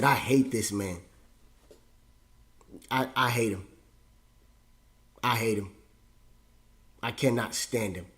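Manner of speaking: low, hushed admission with long pauses; subdued hatred. Retold or imitated. Son admitting he hates his dad, low and subdued. Grave confession after being cussed out.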